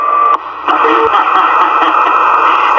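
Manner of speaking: a short real laugh; breathy high laugh at the joke. Real, not acted.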